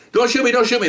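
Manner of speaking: high, repeated begging; acted urgent plea. Acts out plea at gunpoint.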